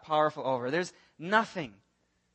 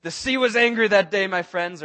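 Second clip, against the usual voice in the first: Seinfeld quote; 2 semitones higher, 8 dB louder than usual. Retold or imitated. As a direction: ham up the famous sitcom line. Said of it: "What did, uh, Will Ferrell say".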